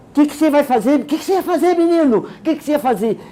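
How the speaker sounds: high, sharp scolding teacher; a teacher shrieking at a six-year-old, high and sharp; mock shrill scolding. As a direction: imitate a hysterical teacher. Teacher scolding a shy boy.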